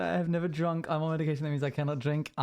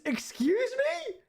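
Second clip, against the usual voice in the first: shocked; slower than usual. Acted. Mock shock at mods drinking.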